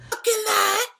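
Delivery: shrieking high, loud and crying out in disbelief; outraged disbelief. Real, not acted. Woman finding her tequila bottle gone, shrieking in outrage. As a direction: scream it out, betrayed and furious.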